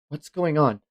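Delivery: ordinary talk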